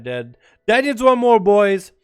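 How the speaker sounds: ordinary delivery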